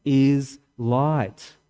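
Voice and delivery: "God is light" drawn out word by word; very slow. Shown as a demonstration. Translating the Latin for the church, very slow. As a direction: lay each word down slowly. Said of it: "That's exactly what it means".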